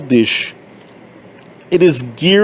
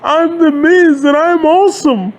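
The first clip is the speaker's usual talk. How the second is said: much higher, cartoonish boastful catchphrase; The Miz impression; 9 semitones higher than usual. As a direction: squeak out a smug brag. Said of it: "And then you've got The Miz".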